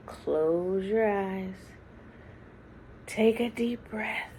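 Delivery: soft whisper, breathy and unhurried; whispered breath cue. Acted. Grandmother whispering calmly to the grandchildren to breathe. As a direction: whisper it like coaxing a child to breathe.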